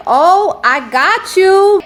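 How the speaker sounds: high, slow drawn-out slogan; delighted callers. Retold or imitated. Host imitating callers repeating her slogan, high and slow. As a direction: savor the catchphrase back at her.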